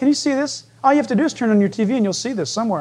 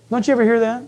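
ordinary talk

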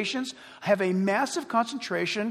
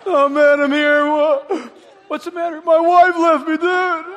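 loud, higher, hammed-up drunken wail; drunk sad guy; 6 semitones higher, 11 dB louder than usual. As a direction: play a sloppy drunk crying over his wife. Drunk man at happy hour.

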